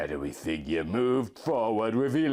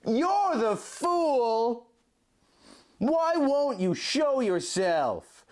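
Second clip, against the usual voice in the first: high, slow, drawn-out yell; screaming; 13 semitones higher, much slower than usual. A narrator's reading. Link screaming at his hidden double, high and slow. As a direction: hurl it at the unseen foe. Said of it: "Link screamed".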